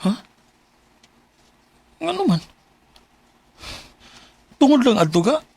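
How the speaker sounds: high, stunned question; shocked. Acted. Boyfriend hearing he is being dumped, shocked. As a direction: voice jumps up in disbelief.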